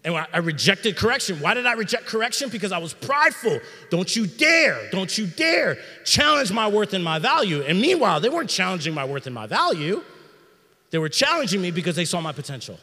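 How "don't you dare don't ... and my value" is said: louder, higher, hitting dare hard; defiant outburst. Retold or imitated. Proud young man refusing correction, defiant and loud. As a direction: bristle and push back. Voicing his prideful self rejecting correction.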